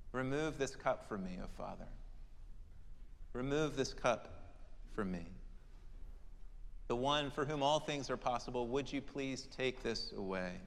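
soft, falling plea to the Father; hushed pleading prayer. Retold or imitated. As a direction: sink the plea down until it nearly fades. Jesus praying, quoted again.

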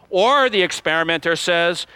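normal talking voice

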